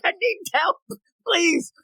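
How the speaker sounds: high-pitched pretend pleading; mock plea for help. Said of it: "She's like".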